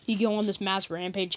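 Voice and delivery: ordinary talk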